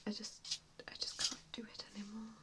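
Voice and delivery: ordinary talk, nothing marked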